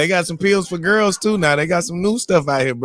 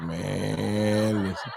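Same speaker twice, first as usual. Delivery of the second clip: much lower and softer, a slow, heavy deep voice; brother's deep voice; 7 semitones lower, 9 dB quieter, much slower than usual. Retold or imitated. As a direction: imitate the friend in a deep, quiet voice. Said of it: "that's how he talked to me".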